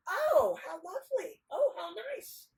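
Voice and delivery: high, exclaimed admiration; hammed-up delight; gushing simpleton. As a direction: coo praise in a very high voice. Nasrudin admires the fighting men.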